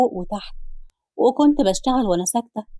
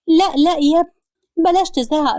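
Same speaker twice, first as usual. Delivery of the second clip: alarmed plea; 6 semitones higher, 4 dB louder than usual. Acted. Mother-in-law stops Ali's scolding.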